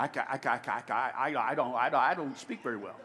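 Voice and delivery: stammering. Acted. Moses stammers excuses to God.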